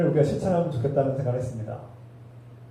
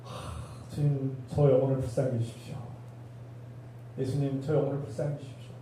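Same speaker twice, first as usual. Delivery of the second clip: hushed prayer; 3 semitones lower, 9 dB quieter than usual. Pretend. Model prayer quoted.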